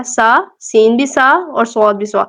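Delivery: ordinary delivery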